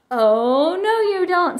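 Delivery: defiant retort, rising high on the end; mildly shouted comeback in a play battle; mock shout back. A narrator's reading. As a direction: push back with a light storybook shout. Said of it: "Sister shouted back".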